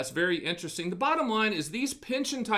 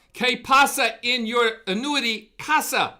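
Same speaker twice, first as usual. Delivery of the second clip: loud, high playful Spanish greeting; mock Spanish phrase; 6 semitones higher, 8 dB louder than usual. Advisor showing off his only Spanish line, a loud playful Spanish greeting. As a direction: belt it out like a cheesy Spanish host. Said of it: "You just heard all I can do".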